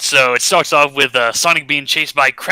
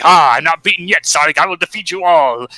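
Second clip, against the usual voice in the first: raised, lightly hammed-up threat; mock villain defiance; 5 semitones higher, 5 dB louder than usual. Robotnik in his giant robot to Sonic, mock defiance. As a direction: vow revenge like a cartoon villain.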